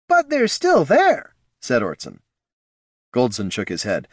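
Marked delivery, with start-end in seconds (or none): 0.1-1.3 nervous advisor voice. Acted. Ortson's line.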